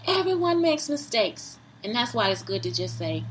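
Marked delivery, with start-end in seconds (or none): 0.1-1.6 emphatic repeat. Acted.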